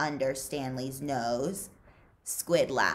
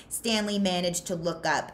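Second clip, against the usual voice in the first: usual speaking voice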